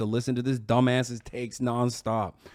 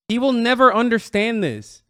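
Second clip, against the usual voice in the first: emphatic; 7 semitones higher, 5 dB louder than usual. Real, not acted. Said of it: "He just won't".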